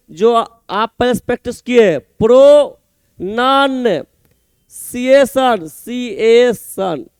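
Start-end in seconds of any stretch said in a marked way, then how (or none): 2.0-7.1 pronunciation very slowly, in separate chunks with pauses; broken into syllables. Shown as a demonstration. Teacher showing students how to say it, pronunciation slowly broken up. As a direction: split pronunciation into pro, non and ciation, each drawn out. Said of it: "देख कैसे बोलेंगे".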